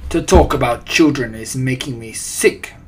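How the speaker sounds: loud, revolted witch; accented villainess outburst; screamed. A narrator's reading. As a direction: declare disgust forcefully. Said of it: "screamed the Grand High Witch".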